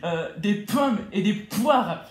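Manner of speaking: loud, slow, spitting the p's; spray the p sounds hard; spitting while talking. Shown as a demonstration. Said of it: "tu vas souffrir".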